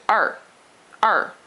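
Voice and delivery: "er" short, dropping sharply from high; short fourth tone. Shown as a demonstration. Teacher showing the fourth tone, short falling yelled "er". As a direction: fire out "er" as if yelling at somebody. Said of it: "like you're yelling at somebody".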